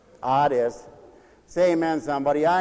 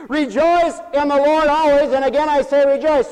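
raised preaching voice; 6 semitones higher, 5 dB louder than usual. Acted. Preacher proclaiming the verse to the congregation, raised and ringing.

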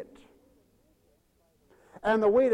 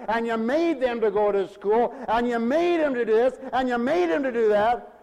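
building sermon refrain; 2 semitones higher, 5 dB louder than usual. Acted. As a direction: drive the refrain home, building each line.

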